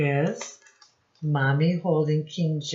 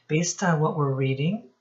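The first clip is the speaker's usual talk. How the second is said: ordinary delivery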